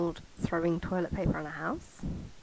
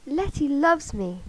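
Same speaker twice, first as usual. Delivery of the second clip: high, loud, drawn-out declaration; excited shout; 7 semitones higher, 7 dB louder, slower than usual. A narrator's reading. Michael announcing Letty's love, narrated excited shout. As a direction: call it out slowly and high. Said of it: "Michael shouted".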